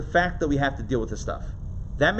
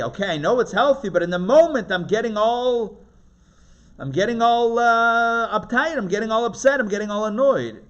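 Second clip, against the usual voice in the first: frazzled parent; 7 semitones higher, 6 dB louder than usual. Retold or imitated. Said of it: "you might say".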